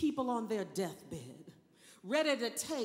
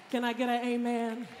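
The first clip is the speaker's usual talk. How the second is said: ordinary talk, nothing marked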